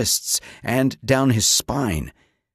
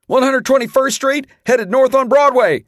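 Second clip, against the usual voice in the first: high, loud urgent shout over noise; soldier shouting; 14 semitones higher, 6 dB louder than usual. A narrator's reading. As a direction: bark the position out fast. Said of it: "the soldier shouted".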